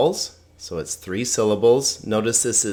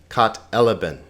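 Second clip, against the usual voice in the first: Kat elaben as kat-E-la-ben; stress on E; faster than usual. Shown as a demonstration. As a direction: hit the first syllable of elaben. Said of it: "hit that syllable that has the accent".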